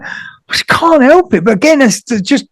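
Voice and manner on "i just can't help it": high-pitched mock whine of protest; helpless protest. Defending yourself to mum about crying, high helpless protest. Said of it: "I was like".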